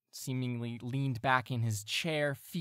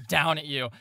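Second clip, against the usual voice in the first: ordinary talk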